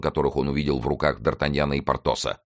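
ordinary delivery

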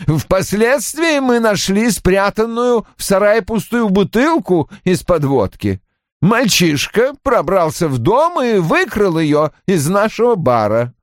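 high-pitched, complaining character voice; peevish man recalling a ruined day; querulous husband. A narrator's reading. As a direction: pitch it up and grumble about the disaster. Narrator voices Roy complaining.

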